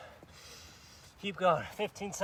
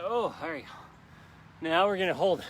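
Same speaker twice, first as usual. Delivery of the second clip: ordinary talk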